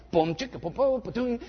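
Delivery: vocal beatbox of a slap bass line; imitated bass riff. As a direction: mouth the Seinfeld bass riff. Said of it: "it always goes back to the bass guitar".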